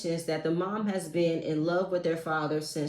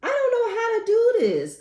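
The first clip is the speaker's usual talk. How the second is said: much higher, louder helpless cry; overwhelmed; 6 dB louder than usual. Real, not acted. Reacting to the crying call, high and overwhelmed. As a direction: throw up your hands. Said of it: "overwhelmed reaction".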